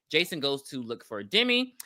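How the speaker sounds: usual speaking voice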